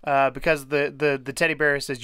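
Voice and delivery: normal talking voice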